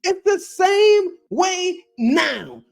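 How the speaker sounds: exasperated. Real, not acted. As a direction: stretch it out, fed up and pleading. Exasperated rant at fans.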